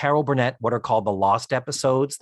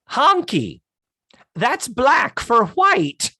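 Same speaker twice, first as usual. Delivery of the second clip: much higher, slow and bright; sugary high lady; high gushing voice; 10 semitones higher, 4 dB louder, much slower than usual. Retold or imitated.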